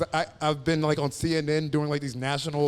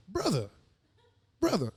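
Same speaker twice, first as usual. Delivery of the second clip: mock pastor; 11 semitones higher than usual. Pastor voice retold.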